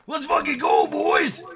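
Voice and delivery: big high hype yell; hype shout. Shown as a demonstration. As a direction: scream it like a rally cry. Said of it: "Have a little emphasis".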